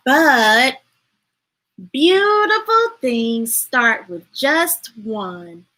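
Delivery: slow, measured storybook read; unhurried read-aloud; reading to children. Acted. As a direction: let each line land for the kids. Reads picture book aloud.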